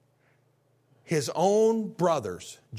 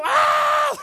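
high shouted startled shriek; startled kid yelp; 9 dB louder than usual. Retold or imitated. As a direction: yelp like caught children. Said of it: "they'd turn around and go".

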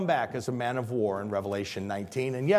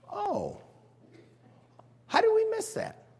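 amazed realization. Retold or imitated. Apostles realizing afterwards.

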